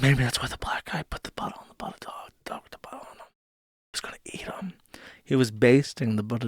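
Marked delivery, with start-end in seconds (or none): 0.0-4.7 hushed, breathy secret-telling; conspiratorial whisper. Acted. Streamer whispering a joke theory to chat, hushed. Conspiratorial aside about Butter Dog.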